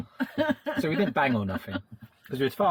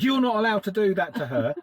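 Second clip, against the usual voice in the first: raised, higher-pitched mock scolding; imitated telling-off; 6 semitones higher, 4 dB louder than usual. Retelling being told off over a kiss, mock scolding. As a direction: retell a stern ticking-off, louder. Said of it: "I got told off by Taffy".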